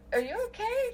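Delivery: lifted concerned check-in to her friend; concerned question. Retold or imitated. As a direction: ask it gently and high, checking on her. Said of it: "I goes".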